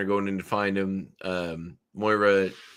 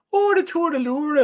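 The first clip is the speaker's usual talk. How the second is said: high, loud, shrill leprechaun lilt; shrillish leprechaun woman; 5 dB louder than usual. Shown as a demonstration. Podcaster mocking Moira's Scottish accent, shrill leprechaun woman. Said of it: "It's like a shrillish".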